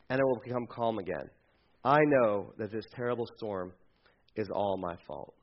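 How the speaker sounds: soft, low self-blame; hushed, resigned surrender; quiet resignation. Acted. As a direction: give yourself up quietly. Jonah offering himself.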